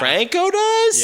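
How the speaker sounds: high, loud, drawn-out disbelief; utterly taken aback; incredulous outburst. Real, not acted. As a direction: blurt it out as a stretched, high-pitched question. Mock outrage at the top billing.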